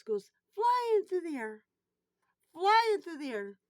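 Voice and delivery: loud, high, slow wail of dismay; excited retelling. Real, not acted. To viewers, reliving the cash blowing off in alarm. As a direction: stretch it out as the money flies away. Money blowing away on ice.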